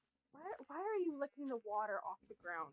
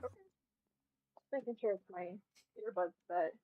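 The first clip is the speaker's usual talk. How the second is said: normal talking voice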